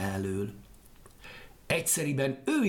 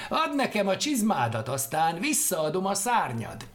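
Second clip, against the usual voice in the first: loud, mocking demand through laughter; laughing wickedly; 7 semitones higher, 4 dB louder than usual. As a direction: taunt him while still laughing. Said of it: "nevetett gonoszul". (gonoszul nevetve, hangosan)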